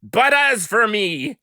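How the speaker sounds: impassioned climax. Acted. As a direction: rise to a burning plea for liberty. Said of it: "The following is a dramatic reading".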